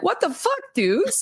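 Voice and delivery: high, shrill-free mock outrage; shocked girlfriends. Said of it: "And if they're like".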